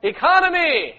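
ECONOMY called out high and loud; emphatic shout. Real, not acted. Leader emphasizing the word economy to the group, emphatic shout. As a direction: ring out "Economy" to rally the group. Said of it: "you emphasize the word "economy".

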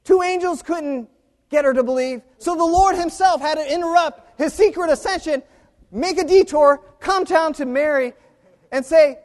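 high, loud, worked-up storytelling; impassioned preaching. Real, not acted. Preacher telling how the Lord had to detour, impassioned. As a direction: preach it at full pitch, incredulous.

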